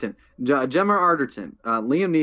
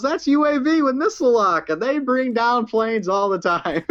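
higher, matter-of-fact mock calm; imitating Murdoch; 11 semitones higher than usual. Host acting Murdoch's missile line, higher and breezy. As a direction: deliver doom cheerfully. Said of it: "He goes".

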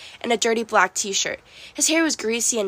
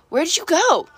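high-pitched searching call; 8 semitones higher, 4 dB louder than usual. A narrator's reading. Grover calls after Juniper from the arena, calling out. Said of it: "Grover called from inside the arena".

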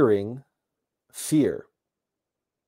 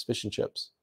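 breathy whispered repeat, much softer; whispered echo; 9 dB quieter than usual. Real, not acted. Recalling what the relatives served, whispered. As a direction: whisper it back to yourself, almost unvoiced.

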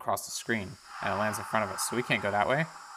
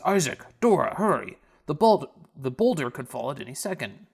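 louder, higher call, pushed on the bul-; urgent warning; 4 semitones higher, 6 dB louder than usual. Acted. Villager running in warning Dora, loud and high. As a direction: burst in calling them to hurry. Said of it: "somebody comes running in the door and says".

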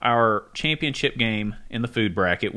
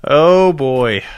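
loud groan; 3 semitones higher, 10 dB louder than usual. Real, not acted. Groaning at a bad joke.

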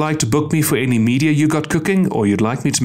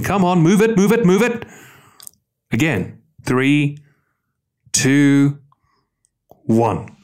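barking gym coach. Pretend. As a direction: bark it like a coach rushing recruits. Urging listeners to hurry up.

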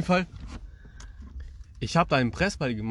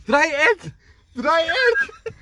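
high, loud shout imitating teammates; teammates screaming; 13 semitones higher, 7 dB louder than usual. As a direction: yell it across the pitch like a teammate. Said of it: "mein Team schreien so".

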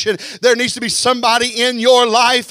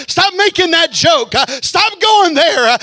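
slow, higher, shouted command; preaching shout; 6 semitones higher, 5 dB louder, slower than usual. Acted. Preacher commanding the congregation, slow shout. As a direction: shout it down at them, slowly.

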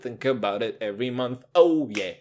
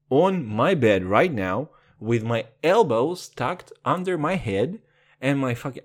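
ordinary talk, nothing marked

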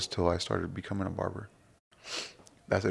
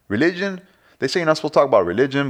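much higher, louder and fast; sudden lively jump to religion; excited; 6 semitones higher, 7 dB louder than usual. Real, not acted. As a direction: burst out with the topic. Sudden emphatic topic shift.

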